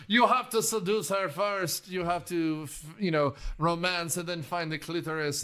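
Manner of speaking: exaggerated basic French accent. Podcaster mimicking an actor's seduction advice in a basic French accent. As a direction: put on a cheesy, stupid French accent. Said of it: "Jerry Orbach is doing a French accent".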